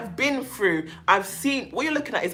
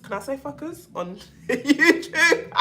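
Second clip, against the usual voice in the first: laughing, cheeky and higher; 6 semitones higher, 5 dB louder, slower than usual. Real, not acted. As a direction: giggle through the question.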